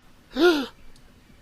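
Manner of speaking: dramatic gasp, high; loud mock gasp. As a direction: gasp in fake shock. Mock shock at own story.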